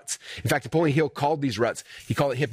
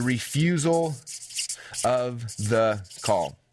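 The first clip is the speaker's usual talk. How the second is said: drawn out; 3 semitones lower, much slower than usual. Shown as a demonstration. Dramatic slow drop on story term.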